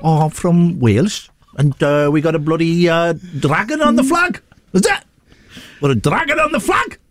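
comic Welsh outburst with soaring pitch; Welsh accent. Acted. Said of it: "I'll do the Welsh accent again".

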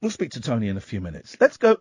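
normal talking voice

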